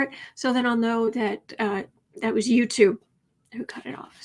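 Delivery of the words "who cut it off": whispered. Real, not acted. Host confiding to viewers about YouTube, whispered. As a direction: drop to an unvoiced whisper.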